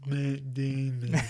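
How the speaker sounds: drawn-out mock puppet delivery of the name; Team America puppet. Riffing on a favourite movie, a lightly hammed-up puppet voice.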